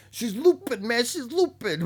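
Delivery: high, loud catchphrase call; Zarko impression. Quoting a catchphrase about her getting caught, high and loud. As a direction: pitch it way up and holler it. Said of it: "what does Zarko say".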